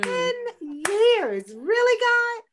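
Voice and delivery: outraged disbelief at God. Retold or imitated. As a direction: throw the question up at God, loud and high. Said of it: "but I was like".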